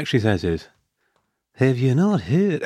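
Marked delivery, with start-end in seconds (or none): 1.5-2.6 slow, drawled Southern gentleman voice; a slow courteous Southern drawl from a road-rage driver; Southern gentleman accent, slow and polite. Retold or imitated. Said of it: "Is this like a southern accent".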